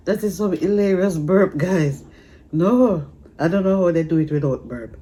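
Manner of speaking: loud, high, tickled; excited, still laughing; amused and loud. Real, not acted. Still laughing about burps.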